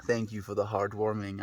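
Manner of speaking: ordinary talk, nothing marked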